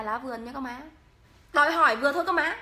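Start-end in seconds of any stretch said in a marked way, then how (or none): 1.5-2.6 fast, louder mock scold; louder, faster mock scolding of viewers; playful scolding. Real, not acted. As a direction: tell them off, half joking.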